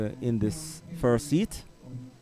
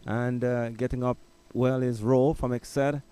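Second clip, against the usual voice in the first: race caller; 3 semitones higher than usual. Acted. Live call of men's race.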